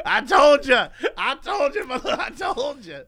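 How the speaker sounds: mock told-you-so from a cranky elder; grumpy old person. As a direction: cackle it out like a smug old man. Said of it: "I'm like".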